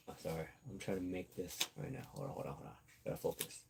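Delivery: quiet, distracted aside to herself; barely voiced gotta focus; muttered aside. Real, not acted. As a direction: drop away from the mic, busy with something. Aside while making something.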